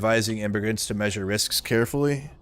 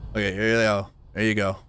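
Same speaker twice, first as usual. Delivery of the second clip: usual speaking voice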